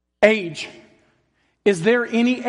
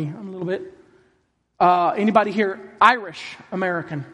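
normal talking voice

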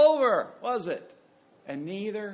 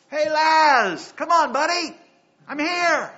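higher and louder, friendly and offhand; casual buddy call; 9 semitones higher, 10 dB louder, slower than usual. Retold or imitated. Jesus calling his dead friend like a buddy, breezy mock call. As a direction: greet him like a pal at the door.

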